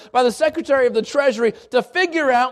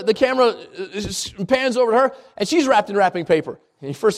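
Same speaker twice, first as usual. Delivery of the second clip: ordinary talk, nothing marked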